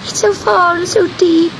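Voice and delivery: high, mock-tearful little cat voice; sobbing kitten. A narrator's reading. Kitten afraid to jump into the sea, high sobbing voice. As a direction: whimper it high and small. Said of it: "sobbed Gobelino".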